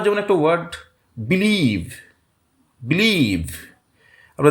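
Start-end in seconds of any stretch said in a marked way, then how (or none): 1.2-1.9 believe with a long, stressed লিভ that falls away; stress on second syllable. Shown as a demonstration. Teacher giving an example word, believe stressed on লিভ. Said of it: "লিভের উপর stress পড়ছে". 2.8-3.5 believe with short বি, long falling লিভ; stress on second syllable. Shown as a demonstration. Teacher repeating the example for students, believe stressed on LIEVE. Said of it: "লিভের উপর stress পড়ছে".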